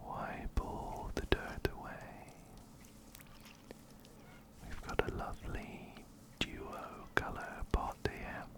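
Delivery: normal talking voice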